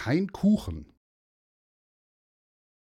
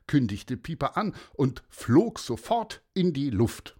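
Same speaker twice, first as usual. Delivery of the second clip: plain reading voice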